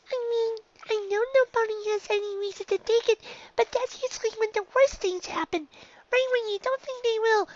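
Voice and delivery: put-on high young filly voice, worrying; a nervous little unicorn fretting to herself; high filly voice, anxious and rambling. Dinky's fretting inner voice.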